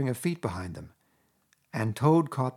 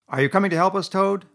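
Rat, stern and loud, a bit higher; stern demand; 9 semitones higher, 8 dB louder than usual. A narrator's reading. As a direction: press him firmly, half scolding. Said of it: "he demanded sternly".

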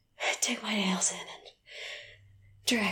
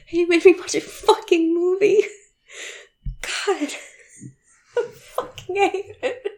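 much higher and louder, half laughing; loud teasing fake anger ending in a laugh; mock furious outburst; 9 semitones higher, 10 dB louder than usual. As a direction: rant with playful mock rage.

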